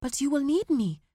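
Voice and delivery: lightly voiced pleading son. A narrator's reading. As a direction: plead with him. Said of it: "pleaded Bobo".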